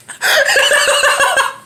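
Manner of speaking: high-pitched helpless laughter; real laughing fit. Real, not acted. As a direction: crack up at your own story. Cracks up at own story.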